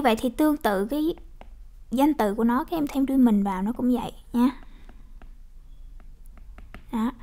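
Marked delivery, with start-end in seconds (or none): none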